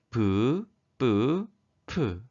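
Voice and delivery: 브, 뿌, 프 as separate, evenly spaced syllables; consonant demonstration. Shown as a demonstration. Teacher models consonants grouped by strength, 브, 뿌, 프 sounded out separately. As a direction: sound out 브, 뿌, 프 one at a time: plain, tense, aspirated. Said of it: "자음들을 발음해 볼까요".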